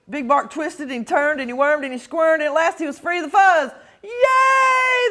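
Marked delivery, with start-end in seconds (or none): 4.0-5.1 squealing crowd cheer. Acted. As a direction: cheer the escape. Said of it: "the crowd cheered".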